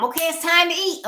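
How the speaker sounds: high, loud call to dinner. Retold or imitated. Mother calling her gaming son to dinner, high and loud. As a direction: call him from the other room.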